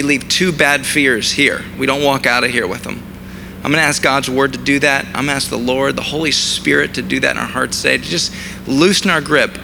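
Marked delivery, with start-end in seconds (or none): none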